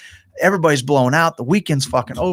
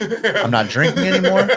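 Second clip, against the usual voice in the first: higher, laughing through the words; 6 semitones higher than usual. Real, not acted. Laughs at his vow.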